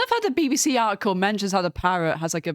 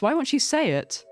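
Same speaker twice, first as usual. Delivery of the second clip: ordinary talk, nothing marked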